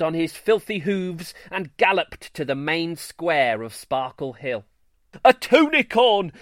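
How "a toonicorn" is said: high, loud shout. A narrator's reading. Greenstar shouting the news through town. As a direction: yell it across the square. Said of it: "he shouted at the top".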